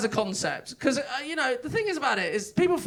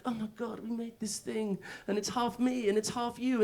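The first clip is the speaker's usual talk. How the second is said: gushing new parents; 9 dB quieter than usual. Retold or imitated. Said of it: "They're like".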